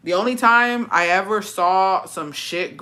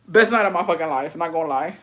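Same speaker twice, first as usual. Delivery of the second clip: ordinary delivery, nothing marked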